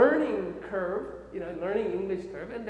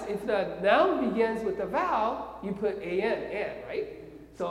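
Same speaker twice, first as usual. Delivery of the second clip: ordinary talk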